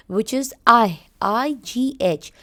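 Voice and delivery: ordinary talk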